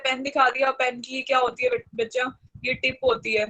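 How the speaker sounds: ordinary talk, nothing marked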